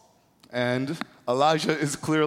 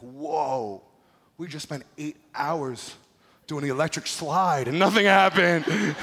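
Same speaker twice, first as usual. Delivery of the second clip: stunned; 4 semitones higher, 4 dB louder, slower than usual. Retold or imitated. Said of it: "because they're like".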